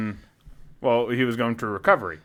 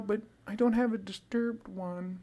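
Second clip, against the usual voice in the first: high, soft, slow mimicry; mock girlish voice, high and small; imitated girl pleading; 6 semitones higher, 9 dB quieter than usual. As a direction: draw it out quietly as the pleading fan.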